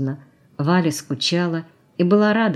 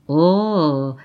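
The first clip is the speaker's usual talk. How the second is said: long, drawn-out mocking "oh"; mocking drawl; 4 dB louder than usual. A narrator's reading. Alla answers his hint, drawling mockingly. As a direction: stretch it out in derision. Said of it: "протянула она насмешливо".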